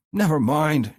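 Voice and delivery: high, loud dismissal; bellowed. A narrator's reading. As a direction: bellow it, furious. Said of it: "she bellows".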